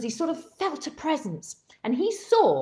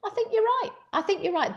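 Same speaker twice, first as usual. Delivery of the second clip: quoting Mary Beard; 11 semitones higher, faster than usual. Retold or imitated. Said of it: "And she said".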